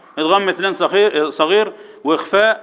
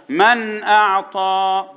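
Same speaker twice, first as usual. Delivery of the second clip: very slow, sustained tajweed chant; recitation to repeat; 3 semitones higher, much slower than usual. Shown as a demonstration. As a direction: lead the class in chanting the verse. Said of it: "قول وراي".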